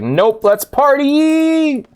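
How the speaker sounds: high, slow, stretched-out party whoop; mock party cry. Streamer imitating himself choosing to party, high and drawn out. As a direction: sing it out like a giddy reveller. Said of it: "but I was like".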